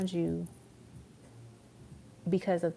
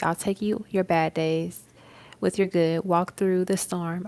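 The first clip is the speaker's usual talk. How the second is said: louder, earnest reading of love lyrics; dramatic lyric recitation; 6 dB louder than usual. Acted. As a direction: deliver it like a spoken vow. Recites [name] lyric.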